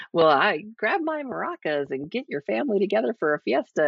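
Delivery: high, over-cheery canned assistant voice; mock a clueless scripted voice assistant; cheesy assistant voice. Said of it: "written by somebody who was clearly not Mexican".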